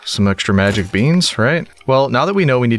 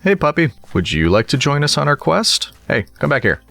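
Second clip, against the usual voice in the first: higher, friendly pet-talk voice; talking to puppy; 7 semitones higher than usual. Real, not acted. Player inviting a puppy on a quest, pet talk. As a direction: invite the dog along in a lifted voice.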